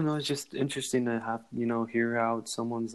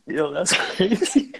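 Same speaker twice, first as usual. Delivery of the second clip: laughing loud and high; cracking up mid-word; 8 semitones higher, 10 dB louder, slower than usual. Real, not acted.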